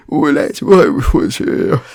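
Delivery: loud, slow bloke call; blokey mate. Retold or imitated. Mate finding Barry at the gallery, loud and slow.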